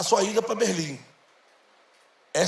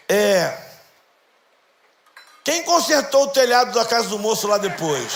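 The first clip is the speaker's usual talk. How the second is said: put-on child's voice; 2 semitones higher, 6 dB louder than usual. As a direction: voice the son asking innocently. Son asks roof question, laughing. (filho pergunta, mais alto e agudo, rindo)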